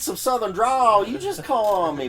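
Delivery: put-on flirt in a thick Texas accent; Southern Texas accent. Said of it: "I can do that in a Texas accent".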